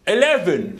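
put-on Scot trying on an English accent; loud, mock-English pronunciation; attempted English accent. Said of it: "Try an English accent, right?".

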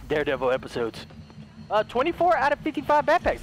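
usual speaking voice

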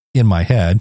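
usual speaking voice

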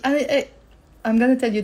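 ordinary delivery

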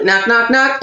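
ordinary delivery

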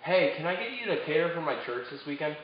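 usual speaking voice

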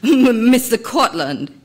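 stammering. A narrator's reading. Photographer nervously addressing the famous guru, stammering. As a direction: falter as you appease him. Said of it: "he stammered".

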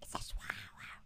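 hushed whisper while hiding. Real, not acted. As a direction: whisper it so nothing hears.

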